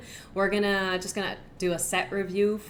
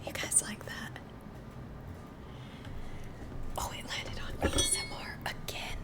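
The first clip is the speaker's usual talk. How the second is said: whispering. Real, not acted. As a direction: whisper gently for the ASMR stream. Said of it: "so I have to whisper now".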